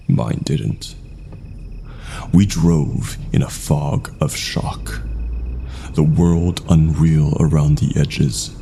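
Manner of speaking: ordinary delivery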